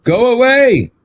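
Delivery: high-pitched mock yell; mock shout. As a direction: call it out high, as if shouting someone off. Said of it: "I shouted at him".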